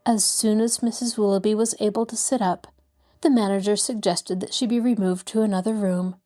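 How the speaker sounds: usual speaking voice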